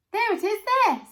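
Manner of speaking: high, loud shout from the crowd. A narrator's reading. A bystander in the crowd shouts for the ticket. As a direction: call over the crowd. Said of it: "somebody shouted".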